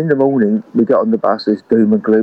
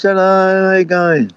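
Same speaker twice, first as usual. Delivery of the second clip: a hammed-up, higher, cheery drunk hello; tipsy stage greeting; 8 semitones higher than usual. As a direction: play the tipsy singer waving at the crowd, pitched up. Drunk singer greets the audience.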